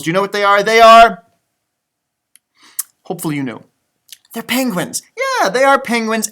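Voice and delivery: ordinary talk, nothing marked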